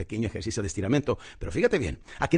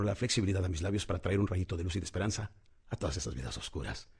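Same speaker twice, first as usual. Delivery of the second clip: low, soft prayer voice, sinking at the end; 5 semitones lower, 4 dB quieter than usual. Shown as a demonstration. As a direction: pray humbly in a lowered voice. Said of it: "una pequeña oración".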